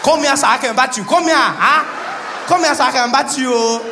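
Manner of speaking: put-on Nigerian accent, playful menace; hammed-up Nigerian-accented shark. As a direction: taunt like a Nigerian tough guy, rising to a wail. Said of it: "a buff Nigerian shark just waiting".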